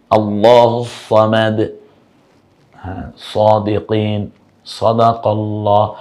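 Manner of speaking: slow recited আল্লাহু Samad; recited examples. Shown as a demonstration. Teacher reciting example words slowly. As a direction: intone the Arabic words slowly. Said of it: "একটু মুখটা গোল করে".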